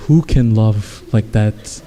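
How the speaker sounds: low, slow, weary-paced reply; lightly voiced husband, dropped low and slow; retold husband. Husband answers his wife's movie complaint, low slow retort. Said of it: "the husband's like".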